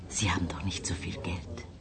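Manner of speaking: very soft, quick whisper; whispered aside. Acted. Guest to Andreas about paying separately, discreet whisper. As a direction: whisper it discreetly, low and fast.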